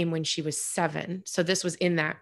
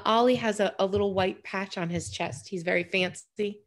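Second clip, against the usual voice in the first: ordinary delivery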